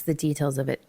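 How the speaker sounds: usual speaking voice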